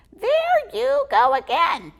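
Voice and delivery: high, loud squeaky squirrel; exasperated little squirrel. Acted. Squizzy exasperated with Marcus, squeaky.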